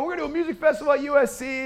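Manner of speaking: high-pitched, quick proclamation; excited announcing. Retold or imitated. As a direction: rush out the big news to everyone. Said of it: "I start announcing it to everyone".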